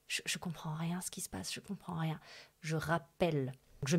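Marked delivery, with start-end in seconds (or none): 0.1-2.2 breathless whisper of shock; stunned whisper. Retold or imitated. Backstage, whispering to herself in shock. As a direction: whisper it as if the world just stopped. Stunned after the phone call.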